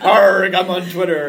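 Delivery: loud hammy pirate growl. Pretend. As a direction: ham up a pirate. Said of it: "don't talk like a pirate".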